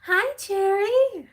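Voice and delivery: very high, loud, drawn-out greeting; exaggerated squeaky put-on voice; Patricia. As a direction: stretch it out high and loud, hammed-up. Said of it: "I'm gonna do Patricia's voice".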